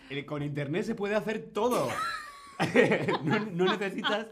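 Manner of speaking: defending the answer through giggles; laughing. Real, not acted. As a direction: talk while laughing hard. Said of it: "estás casi llorando de la risa".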